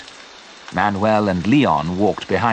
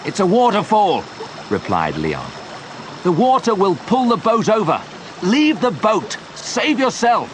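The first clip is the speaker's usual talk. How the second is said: high urgent pleading; urgent warning; 11 semitones higher than usual. A narrator's reading. Leon urging Joe to leave the boat, urgent. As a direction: warn him, then beg.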